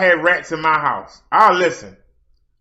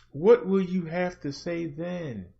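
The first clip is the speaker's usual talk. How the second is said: quieter repeat; 3 semitones lower, 10 dB quieter than usual. Real, not acted. Repeated challenge, fading.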